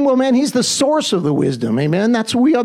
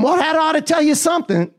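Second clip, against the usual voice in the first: ordinary talk, nothing marked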